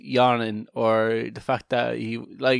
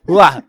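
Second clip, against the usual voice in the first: mock baby cry; 5 semitones higher, 10 dB louder than usual.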